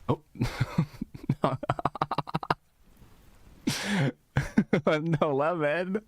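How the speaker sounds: high, slow, drawn-out words through laughter; helpless high-pitched laughing delivery; real laughing fit. Real, not acted. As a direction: squeal it out while cracking up. Cracking up at the chat.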